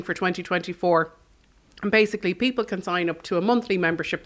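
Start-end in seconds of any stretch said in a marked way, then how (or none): none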